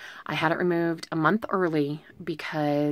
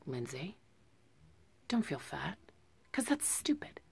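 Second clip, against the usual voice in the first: self pep talk; 11 dB quieter than usual. Real, not acted. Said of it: "I'm making this for me".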